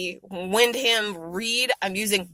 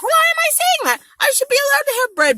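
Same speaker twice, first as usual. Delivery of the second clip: indignant inner voice. Retold or imitated. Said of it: "And then I'm like".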